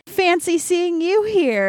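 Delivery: high, slow teasing flirt; flirty. Retold or imitated. Handsome writer greeting Andy at the door, slow flirty tease. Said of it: "kinda, like, flirty".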